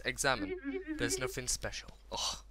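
usual speaking voice

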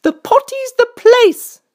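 high, loud storybook queen. A narrator's reading. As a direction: declare it slowly and firmly as the high-voiced queen. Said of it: "said the queen".

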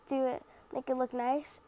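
ordinary talk

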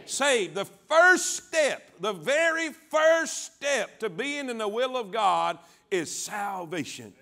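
slow, booming declaration with stretched words; full-force preaching. Real, not acted. Preacher urges everybody to declare salvation, full-force preaching. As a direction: proclaim it word by word for all to repeat.

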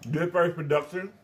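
usual speaking voice

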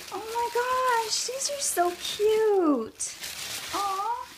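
high, slow coo; drawn-out high so cute. Real, not acted. Cooing over spools.

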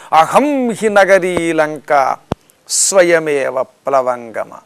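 very slow intoned Sanskrit; slow solemn shloka; verse recitation. Acted. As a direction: chant it slowly and proudly.

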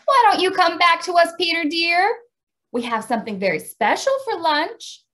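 high, loud mother calling from the window. A narrator's reading. Mother calling her son back from the window. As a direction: call him home sweetly from afar. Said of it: "His mother came to the window and called".

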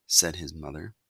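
ordinary delivery, nothing marked

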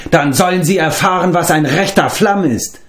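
a cheerful, bragging storyteller's bard; boastful bard. A narrator's reading. Bard Flefdur boasts to Taran, lightly voiced and eager.

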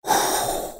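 imitated long, voiceless exhale; deep breath out. Fan imitating the young player's relief, long airy exhale. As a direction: mimic a player blowing out a heavy breath. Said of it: "he probably took a deep breath".

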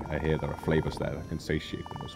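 low, grave narrator of a quest; wise mentor voice. Acted. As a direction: speak like a solemn sage. Voices game character dialogue.

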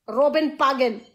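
normal talking voice

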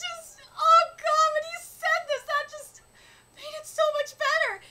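overcome, voice cracking between laughs and tears; near tears. Real, not acted. Moved to tears by scene.

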